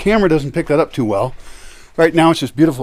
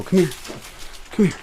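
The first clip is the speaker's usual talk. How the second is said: usual speaking voice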